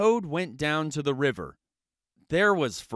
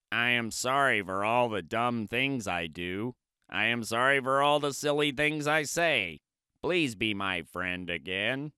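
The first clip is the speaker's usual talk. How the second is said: apologetic Toad; 4 semitones lower than usual. Acted. Toad apologizing to Frog, pleading. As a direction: beg forgiveness as Toad.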